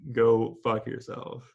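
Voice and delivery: slow, flat repeat of the insult; deadpan repeat. Retold or imitated. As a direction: say each word slowly, straight-faced. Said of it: "Just straight up".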